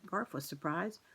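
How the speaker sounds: ordinary delivery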